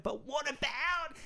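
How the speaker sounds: high, slow, nervous squeak; timidly unsure what to say; anxious inner voice. Retold or imitated. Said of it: "And I was like".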